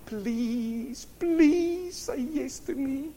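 high, drawn-out pleading whine; exaggerated high-pitched begging; mock pleading. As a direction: beg slowly in a high, wheedling voice. God mock-pleading for acceptance.

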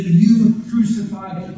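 "you" punched out, slow and deliberate; slow phrase with heavy weight on "you"; emphatic stress on YOU. Shown as a demonstration. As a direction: hit the word "you" hard, accusingly. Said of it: "is emphatic".

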